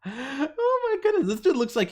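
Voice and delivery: amused disbelief. Real, not acted. Reacting to this dude on the stream, amused disbelief.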